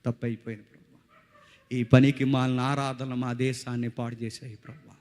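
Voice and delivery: usual speaking voice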